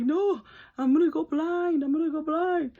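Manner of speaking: high, loud, drawn-out cry of mock despair; panicked wail. Younger self to sister after eye injury, acted panicked wail.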